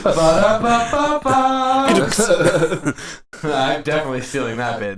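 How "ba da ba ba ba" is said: high, slow laughing scat; sing-laugh nonsense syllables; playful laughing scat noise. Acted.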